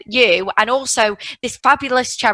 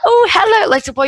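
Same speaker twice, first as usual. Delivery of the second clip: high sing-song greeting; 9 semitones higher than usual. Retold or imitated. Stranger greets child in shop.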